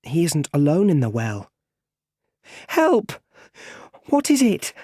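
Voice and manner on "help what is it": put-on high-pitched child's scream for help; frightened cry. A narrator's reading. Boy hearing strange sounds in the well, crying out afraid. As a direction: call out as a scared small boy, high and loud.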